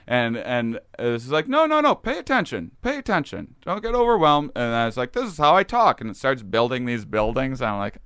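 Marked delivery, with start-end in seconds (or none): 1.5-4.5 much higher voice insisting; entity instructing. Retold or imitated. Entity telling overwhelmed [name] to pay attention, much higher. 5.1-6.0 higher, louder explaining; entity voice. Retold or imitated. Entity explaining to [name] how it talks, higher.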